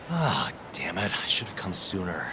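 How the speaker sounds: soft, deflated regret; disappointed. Acted. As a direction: sigh it quietly to yourself. Customer regrets missing the sold-out mochi.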